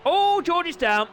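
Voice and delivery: high, loud gasp of a call; excited crash call. Acted. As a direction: yelp it as the bike goes down. Commentating a crash.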